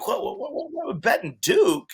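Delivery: put-on a kid stunned at being told to bet on hopeless Duke, in sputtering disbelief; incredulous disbelief. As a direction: sputter it out, unable to believe the bet.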